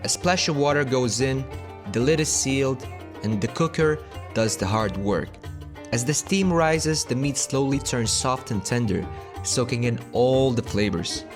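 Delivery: low, soft, measured narration; documentary voice-over. Acted. Recorded food voice-over narration.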